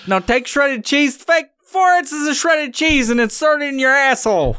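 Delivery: hammed-up cookbook reading; mock cookbook voice. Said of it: "It's just like".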